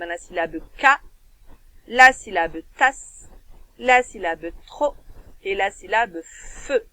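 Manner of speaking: "ka", "tas", "tro", "feu" each set apart, "tas" loudest; teacher breaking a word into syllables. Shown as a demonstration. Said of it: "il y avait plusieurs syllabes".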